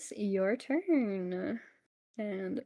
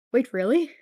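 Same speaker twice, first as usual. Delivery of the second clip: surprised; 3 semitones higher, 9 dB louder than usual. Acted. GM voices surprised NPC.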